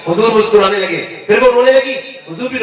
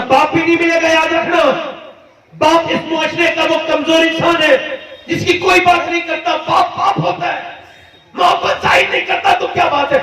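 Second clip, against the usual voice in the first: high, fervent sermon shout; impassioned preaching; 9 semitones higher than usual. Acted. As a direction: preach it out with full force. Preaching at full force. (جوشیلا وعظ، بلند آواز میں)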